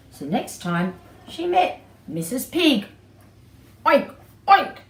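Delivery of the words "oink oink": loud, high oinking; pig oink. Acted. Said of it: "said Missus Pig".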